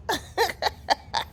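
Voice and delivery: breathy high laugh; airy laugh. Real, not acted. Vlogger laughing at Carol, airy giggle.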